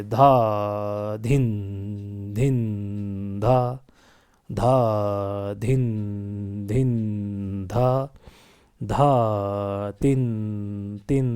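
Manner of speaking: slow, drawn-out tabla syllables, evenly spaced; slow vilambit recitation. Shown as a demonstration. Teacher showing students slow laya in teen taal, vilambit recitation. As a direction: hold each bol long and leave space between. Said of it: "यानी कि slow लय, जैसे".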